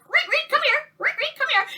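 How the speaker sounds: high, soft calling voice; calling the dog. Retold or imitated. Family calling the dog Rick, high soft call. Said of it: "we'd be calling the dog".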